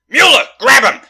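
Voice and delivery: high, very loud urgent command; shouted order. Acted. Detective ordering officer to seize suspect, shouting. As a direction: shout to a partner mid-chase.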